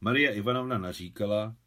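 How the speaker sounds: ordinary delivery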